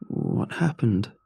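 slow, slurred and groggy. Acted. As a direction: drag the words out half awake. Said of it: "in a groggy slur of speech".

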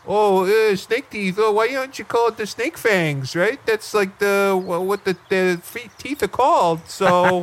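high-pitched mocking imitation of a hesitant questioner; Arnie. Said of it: "That sounds just like Arnie".

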